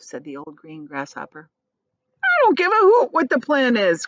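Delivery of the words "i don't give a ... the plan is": high, loud defiant cry; cried. A narrator's reading. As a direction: refuse stubbornly. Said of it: "cried the earthworm".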